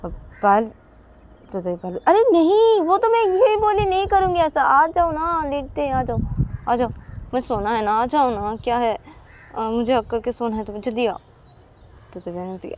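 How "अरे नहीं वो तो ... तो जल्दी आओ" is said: high, insistent voice swearing she won't do it; coaxing promise. Acted. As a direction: plead innocently, then beg her to come. Payal coaxes again.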